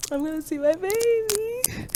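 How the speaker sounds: giddy before the reunion; excited. Real, not acted. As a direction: squeal it out in anticipation. About to see her dog.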